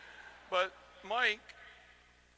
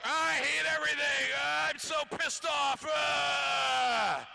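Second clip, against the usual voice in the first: mock pissed-off rant; 8 semitones higher, slower than usual. Mocking angry conservatives, hammed-up pissed-off yelling. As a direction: ham up a furious whine. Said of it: "all day long they're like".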